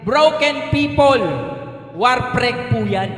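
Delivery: slow, deliberate "broken people"; slow emphasis. Shown as a demonstration. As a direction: spell it out slowly for the congregation. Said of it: "Tandaan po natin".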